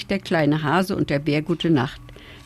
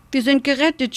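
high-pitched, understated cry of relief; mildly shouted joy, pitch lifted; excited cry; 7 semitones higher than usual. A narrator's reading. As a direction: call it out higher, without full volume. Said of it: "schrien sie beide".

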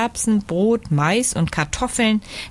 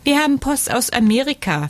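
higher-pitched mother hare announcement; announcing; 6 semitones higher than usual. A narrator's reading. Mother hare tells her children about post, announcing in a higher voice. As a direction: share the news in a lifted voice. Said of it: "verkündete die Hasenmutter".

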